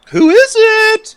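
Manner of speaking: calling out at a knock on the door; nervous call through door. Acted. Answering a knock in character.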